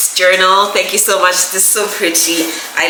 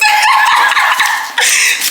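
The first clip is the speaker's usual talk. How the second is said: high, airy squealing laugh; real laughing fit. Real, not acted. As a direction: burst out laughing at yourself. Laughs about used gift cards.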